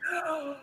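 sharp high gasp of awe; retold gasp of wonder; amazed gasp. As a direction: suck in a breath, wide-eyed. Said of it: "I was like".

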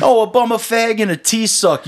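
high-pitched mocking British accent. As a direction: parrot it back in exaggerated British. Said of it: "like a British accent".

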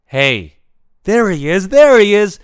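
hammed-up cheer that Mister Big has arrived; excited announcing. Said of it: "Charlotte goes".

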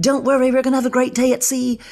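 high-pitched, terrible cruise director impression; Paul Scally. Cruise director placating passengers after a missed port, hammed-up accent. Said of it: "did a terrible impression of".

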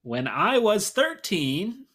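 high, loud, slow stand-up delivery; drawn out like a stand-up comic's setup; John Mulaney. Acted. Co-host reading a song title like John Mulaney, stretched out and loud. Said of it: "That sounded like John Mulaney big time".

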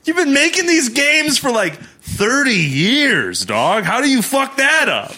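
high, loud disbelief; outraged. Retold or imitated. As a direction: rant in shocked outrage. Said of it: "And I went".

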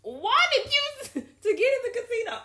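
loud, high-pitched laughing question; incredulous laughing. Real, not acted. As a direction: exclaim in disbelief through laughter. Listener laughing in disbelief.

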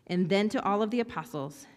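ordinary talk, nothing marked

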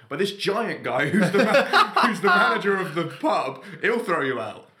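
high, loud, slow, laughing through the line; can't keep a straight face. Retold or imitated. Pub worker retells warning a customer, laughing as she speaks.

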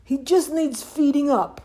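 high, loud voiced dad; dad character. A narrator's reading. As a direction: insist as a storybook father. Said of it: "said Dad".

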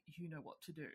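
low, quiet, ominous murmur. Retold or imitated. Swan hands the horn to the cult leader, hushed and ominous. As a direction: hand over the horn with a hushed order. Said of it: "and he's like".

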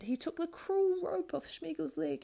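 high creepy creature voice; Gollum voice. Acted. Gollum and Schmiegel debate.

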